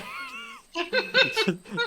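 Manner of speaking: squealing, high laugh. Real, not acted. Laughing at the sticker.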